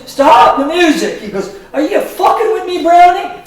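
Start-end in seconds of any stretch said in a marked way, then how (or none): 0.1-1.2 higher, louder mock yell, slow; angry stop. Angry Sonny halts the show. 1.7-3.4 high, loud mock outrage; furious accusation. Sonny confronts Brownie on stage.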